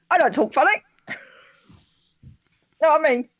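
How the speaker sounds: David Turner. Acted. Answering a viewer's request, loud indignant impression. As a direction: protest loudly in a put-on voice. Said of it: "do a David Turner's impression".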